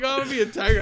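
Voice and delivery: high-pitched, laughing imitation; laughing mockery. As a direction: tease a friend through laughter. Mocking friend's choice through laughter.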